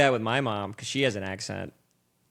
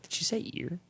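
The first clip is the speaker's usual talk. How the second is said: mock puzzled whisper; 6 semitones higher, 8 dB quieter than usual. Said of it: "people will be like".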